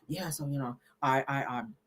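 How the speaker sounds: low, mumbled through barely open lips; lazy low mimic of a man hiding his teeth; mocking Damon talking. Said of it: "he kinda keep his lip up like that".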